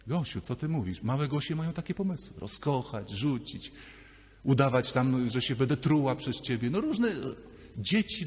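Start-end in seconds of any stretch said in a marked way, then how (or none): none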